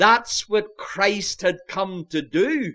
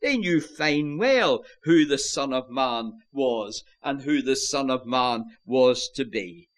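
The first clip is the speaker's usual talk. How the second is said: ordinary talk, nothing marked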